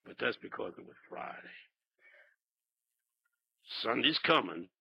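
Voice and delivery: hushed, low preaching; low-gear start. Retold or imitated. Pastor starting the sermon softly in low gear. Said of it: "he said".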